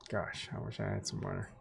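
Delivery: whispering to self; a thirsty whisper under the breath; whispered aside. Real, not acted. Thirsty aside while reading aloud.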